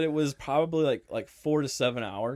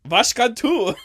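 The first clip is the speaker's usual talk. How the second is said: put-on Vashkhad said high and loud in a German accent; laughing German take on Vashkhad; hammed-up German accent; mock German accent; 9 semitones higher, 8 dB louder, slower than usual. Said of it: "I said that like German".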